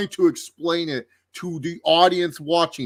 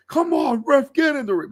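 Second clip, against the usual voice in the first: high, strained mock exasperation at the ref; livid commentator; 13 semitones higher, 4 dB louder than usual. Host imitating commentator urging the ref on, mock livid. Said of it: "He, he seemed livid".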